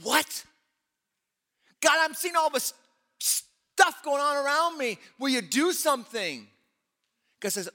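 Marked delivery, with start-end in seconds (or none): 0.0-6.5 high, pleading cry to God; desperate plea. Retold or imitated. Habakkuk begs God to act, high anguished plea. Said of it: "Habakkuk's like".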